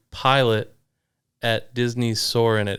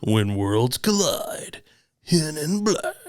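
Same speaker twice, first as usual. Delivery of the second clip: slow, higher botched trailer voice; attempted trailer voice; 6 semitones higher, much slower than usual. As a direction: try a dramatic trailer read and miss it. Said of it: "I can't do it. I don't have the radio voice".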